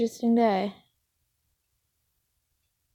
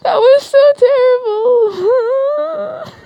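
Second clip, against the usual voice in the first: high, loud, drawn-out laughing wail; 13 semitones higher, 7 dB louder, much slower than usual. Real, not acted. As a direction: squeal it slowly through helpless laughter. Laughing at own failed accent.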